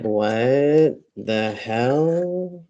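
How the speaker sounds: very slow, drawn-out disbelief; incredulous. Retold or imitated. Recalling reaction to the scolding nurse, incredulous. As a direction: stretch each word, baffled. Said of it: "And I was like".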